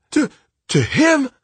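high, loud, stammered outrage; shouted. A narrator's reading. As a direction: break off, then shout it high in disbelief. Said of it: "he shouted".

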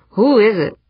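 muffled through a door; demanding through a closed door. A narrator's reading. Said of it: "Eberly's muffled voice demanded".

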